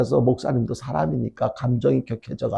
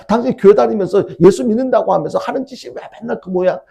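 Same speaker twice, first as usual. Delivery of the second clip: high, louder mock nagging; acted reproach; 7 semitones higher, 5 dB louder than usual. Wife scolding her husband who goes to church, acted exasperated reproach. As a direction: imitate an exasperated spouse's complaint.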